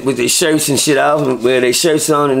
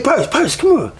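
much higher call of the name; 9 semitones higher than usual. Retold or imitated. Partner with mop bucket calling him over, high excited call. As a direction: wave him over with your voice.

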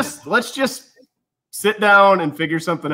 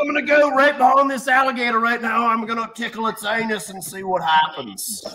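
put-on higher Crocodile Hunter style Australian accent; Australian accent, hushed on the last line; 6 semitones higher than usual. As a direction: narrate like an excited Australian wildlife host. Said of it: "I tried Australian once".

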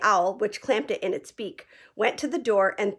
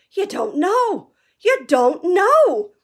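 much higher, slow, dismayed; stunned; 9 semitones higher, 7 dB louder, much slower than usual. A narrator's reading. Hagrid stunned that Harry knows nothing. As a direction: let it sink in slowly.

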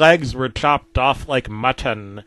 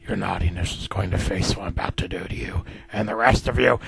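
low, fast threatening whisper; sinister villain whispering a promise; menacing whisper; 7 semitones lower, 5 dB quieter, faster than usual. Acted. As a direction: breathe the threat right in their ear.

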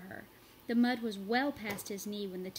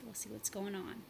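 soft unvoiced whisper; 7 dB quieter than usual. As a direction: lean in and whisper it. Teacher teases the story.

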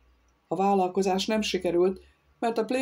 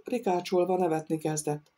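ordinary delivery

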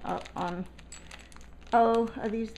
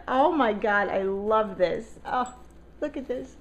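ordinary delivery

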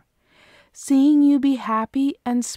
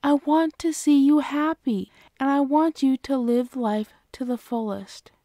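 ordinary talk, nothing marked

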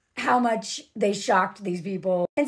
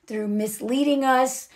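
usual speaking voice

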